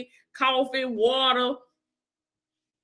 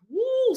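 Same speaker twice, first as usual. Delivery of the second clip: high, joyful church whoop; excited woo; 13 semitones higher than usual. Real, not acted. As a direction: let out a spirited woo. Preacher exclaims about fire.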